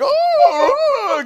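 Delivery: very high, loud flustered oh oh; fretting nobles. Acted. Duke and duchess rushing forward, flustered squeal.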